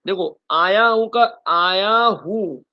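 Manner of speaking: "आया हूँ" loud and drawn out; emphasising "आया हूँ". Shown as a demonstration. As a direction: hammer "आया हूँ" home slowly. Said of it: "देखो जरा ध्यान से देखें". ("आया हूँ" ज़ोर से और खींचकर)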